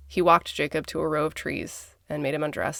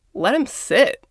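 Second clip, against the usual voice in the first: pitched-up, louder mock demand; emphatic insistence; 3 semitones higher, 6 dB louder than usual. Arguing back at the prosecutors, emphatic and indignant. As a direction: insist it hard, punching the words.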